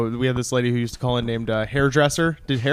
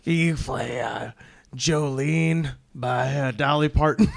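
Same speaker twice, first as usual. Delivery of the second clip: mock drunk caller; 4 dB quieter than usual. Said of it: "she'd be shit-faced and she'd be like".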